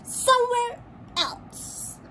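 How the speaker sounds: high, slow duck snap; snapping duck. Acted. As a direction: cut him off curtly. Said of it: "snapped Duck".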